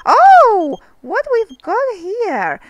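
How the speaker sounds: high, loud oh then slow curious question; sudden delighted discovery; surprised. Real, not acted. Player finding a message in the game, surprised.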